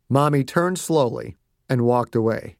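ordinary delivery, nothing marked

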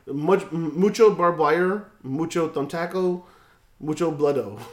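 mucho bloodo with fake Spanish -o endings. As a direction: tack Spanish-style -o onto English words. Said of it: "Stop talking Spanish like Justin".

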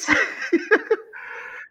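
high breathy laugh. Real, not acted. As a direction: laugh at yourself, high and airy. Laughing at own admission.

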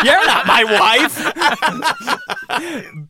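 high and laughing through the words. Real, not acted. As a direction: crack up while saying it. Repeated while laughing.

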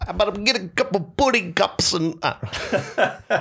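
high, hammed-up sitcom dad; Cliff Huxtable. Doubling down on the fish audition, sitcom dad impression. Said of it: "Cliff Huxtable there".

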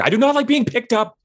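high, fast indignant grumble; high indignant complaint, fast; grumpy complaint. Acted. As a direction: complain about being hoisted. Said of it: "you just hear".

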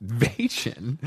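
"Vagin" said softly as VAY-jun; mispronouncing. Retold or imitated. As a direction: echo "Vagin" with a soft J in place of hard G. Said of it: "That sounds like a female sex organ".

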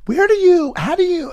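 high-pitched pestering questions; mimicked fan questions. Fans asking the same questions, high mimicry. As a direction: pipe up like an eager fan. Imitates fans' repeated questions.

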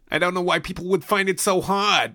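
high-pitched cartoon New Yorker; hammed-up New York accent, prank-call style; exaggerated New York accent. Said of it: "You sound like the Jerky Boys".

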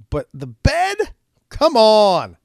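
high, loud incredulous outburst; exasperated disbelief. Real, not acted. Comic ranting about a soiled bed, exasperated disbelief. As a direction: drag out the words in loud, pleading disbelief.

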